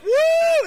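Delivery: very high, loud "woo"; piercingly high celebratory cry; elated whoop. Acted. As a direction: whoop out with joy. Imagined elated whoop in traffic.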